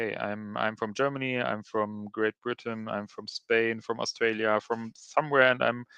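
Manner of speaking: ordinary talk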